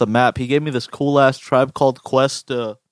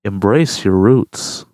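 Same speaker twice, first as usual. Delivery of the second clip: lower, very slow, exaggerated "Embrace your roots."; mock slow deep imitation; 4 semitones lower, much slower than usual. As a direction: drag it out low to mock it. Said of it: "Don't say it like that".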